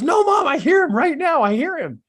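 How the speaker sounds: put-on high, loud child protesting to mom; insistent kid. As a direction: imitate a kid insisting at the top of his voice. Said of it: "And he goes".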